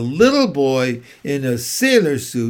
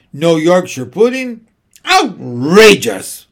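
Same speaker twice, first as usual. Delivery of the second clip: furious admiral; 4 semitones higher, 6 dB louder than usual. A narrator's reading. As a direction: roar it like a furious sea captain. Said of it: "a great commotion was heard".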